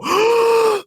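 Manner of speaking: big gasp. Retold or imitated. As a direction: take one huge dramatic breath. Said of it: "And I have to go".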